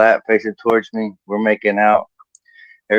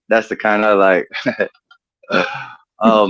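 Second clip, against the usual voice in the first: ordinary delivery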